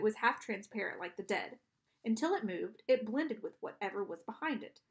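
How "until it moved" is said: ordinary delivery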